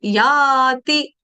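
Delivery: याति very slow with no न sound; no nasal. Shown as a demonstration. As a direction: say याति, not यान्ति. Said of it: "यान्ति नहीं है". (याति, बिना अनुस्वार)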